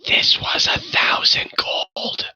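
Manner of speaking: slow, anguished stage whisper. Acted. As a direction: whisper it so the others can't hear. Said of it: "he kind of whispers off in your direction".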